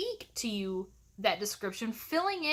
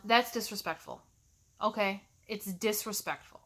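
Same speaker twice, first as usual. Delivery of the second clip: ordinary delivery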